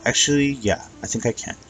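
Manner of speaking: a light eerie spirit voice; Tantabus voice. Acted. Tantabus voiced, far timbre.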